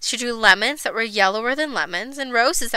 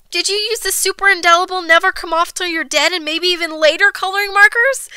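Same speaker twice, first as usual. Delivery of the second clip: higher-pitched anxious question; alarmed mother; 6 semitones higher than usual. A narrator's reading. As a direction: dread building through a long question. Mother questions guilty child.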